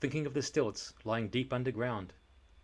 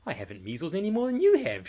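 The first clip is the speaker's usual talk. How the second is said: fast, loud protest; indignant shout; 7 semitones higher, 7 dB louder, faster than usual. Acted. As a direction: fire the denial back. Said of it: "shouted Eric".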